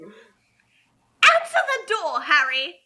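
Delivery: loud, drawn-out screech of a bossy aunt; hammed-up screechy Aunt Petunia calling out. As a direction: yell it down the hall at Harry. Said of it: "I can be Screechy".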